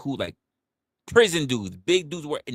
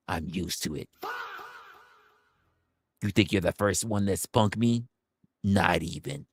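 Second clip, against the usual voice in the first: unbothered tough guy; 5 semitones lower, 3 dB quieter than usual. Retold or imitated. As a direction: play the hardened guy who can't feel the spray. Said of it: "and you're like".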